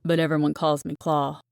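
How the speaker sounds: lightly voiced cocky man; smooth, self-assured correction; a hint of a smug athlete's voice; smooth, condescending. A narrator's reading. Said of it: "his deep voice smooth and condescending".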